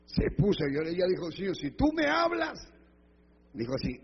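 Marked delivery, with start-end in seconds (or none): 1.3-2.6 high-pitched cry to the Lord; imploring challenge. Retold or imitated. Woman bargains with God in prayer, high and insistent. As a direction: throw the pitch right up as you call on God.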